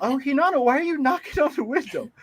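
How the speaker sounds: high-pitched put-on auntie voice; imitated auntie. Auntie questions a late-night knock on the window, high voice.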